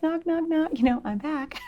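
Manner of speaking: high, light little knock-knock call; sheepish mock greeting. Mom back at the kids' bedroom door, high sheepish call. Acts out sheepish return to kids.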